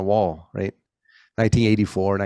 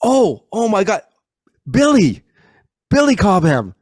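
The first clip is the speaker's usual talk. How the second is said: sudden excited realization; 10 semitones higher, 6 dB louder, slower than usual. Retold or imitated. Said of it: "and I go".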